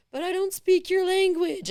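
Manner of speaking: plead, bewildered and slow. Retold or imitated. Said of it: "I remember saying".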